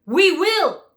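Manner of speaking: high, loud roar of beasts; roaring crowd. A narrator's reading. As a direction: shout it as the whole herd. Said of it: "beasts roared with a mighty roar".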